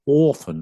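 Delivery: "orphan" with a long aw vowel; long-vowel often. Shown as a demonstration. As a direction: say "orphan" for often, old Queen's English style. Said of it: "They don't say orphan".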